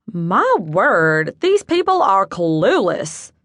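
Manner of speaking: slow, exasperated Southern drawl; Southern accent. Acted. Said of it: "slightly nasal Southern accent".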